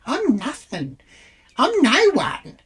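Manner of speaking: high, exaggerated modesty; showy self-deprecation. Retold self-deprecation to her friend.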